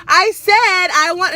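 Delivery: put-on girl suddenly loud at the counter; retold snappy loud reply. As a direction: throw the order back at her with attitude. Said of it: "And she was like".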